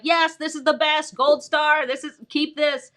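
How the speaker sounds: bright burst of delight; excited praise. Shown as a demonstration. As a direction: cheer them on like you're handing out a gold star. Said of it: "unless I'm excited".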